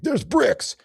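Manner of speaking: very high, loud mimicked exclamation; mock amazed onlooker. Mocking what people will exclaim.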